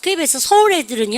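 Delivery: ordinary talk, nothing marked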